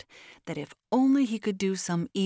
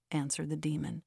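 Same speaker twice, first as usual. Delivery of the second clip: plain reading voice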